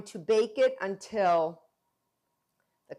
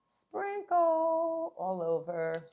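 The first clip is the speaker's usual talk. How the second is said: sprinkle sung high and slow; sing-song high; 9 semitones higher, much slower than usual. Shown as a demonstration. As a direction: stretch "sprinkle" up high, slowly. Sings out the sprinkle.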